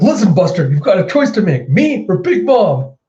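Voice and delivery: loud, hard-pressed demand, slowing at the end; stern ultimatum. Retold or imitated. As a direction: lay down a mock-furious ultimatum. Nami threatens Zeus with ultimatum.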